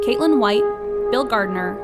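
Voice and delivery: normal talking voice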